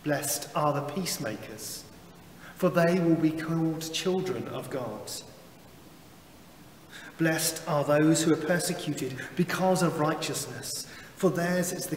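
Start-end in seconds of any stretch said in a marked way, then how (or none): none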